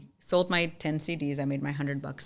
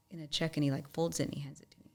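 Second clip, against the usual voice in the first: ordinary talk, nothing marked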